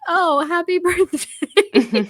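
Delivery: put-on slow, high and laughing, like a sweet boy; sweet drawn-out birthday wish. Podcaster imitating Mac wishing Jen's drunk friend a happy birthday, high and slow. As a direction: stretch out happy birthday with a giggle. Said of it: "I like that he's just like".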